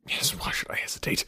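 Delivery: breathless whisper of resolve; whispered to himself. Acted. Knightley steeling himself to speak, whispered. Knightley wavering before declaring love.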